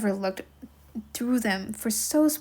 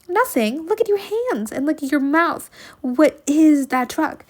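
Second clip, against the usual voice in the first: high, louder disbelieving scold; disbelieving, higher and louder; scolding aunt; 5 semitones higher, 7 dB louder than usual. Acted.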